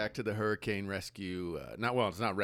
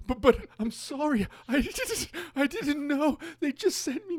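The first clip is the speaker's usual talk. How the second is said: much higher, whiny apologetic voice breaking into a laugh; flustered German reporter; 6 dB louder, slower than usual. Retold or imitated. German reporter apologizes to the heckler, high whiny and laughing. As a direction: squeak out a helpless excuse. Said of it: "And the guy goes".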